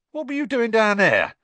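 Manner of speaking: put-on high, loud cheeky young voice; cockney lad. A narrator's reading. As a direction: ask it bluntly like a street lad. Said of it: "dropping his professional manner".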